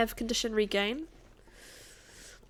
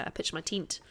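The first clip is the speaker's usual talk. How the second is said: broad Kiwi accent, "tent" said almost like "tint"; 3 semitones lower, 4 dB quieter than usual. Shown as a demonstration. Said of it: "whereas a lot of Kiwis would say".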